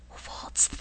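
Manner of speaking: high breathless whisper; awed whisper. A narrator's reading. Doodle hears a strange croak at lunch, whispering. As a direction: whisper it, eyes wide. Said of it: "he whispered".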